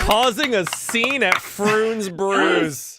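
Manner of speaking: high, slow proclaimed title; mock announcer. Game master capping the session, grand announcing. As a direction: draw it out like an episode title.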